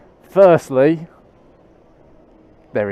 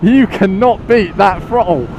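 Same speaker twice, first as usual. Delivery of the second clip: real exhilaration; 6 semitones higher than usual. Real, not acted. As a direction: exclaim it, buzzing from the acceleration. Thrilled by sport mode acceleration.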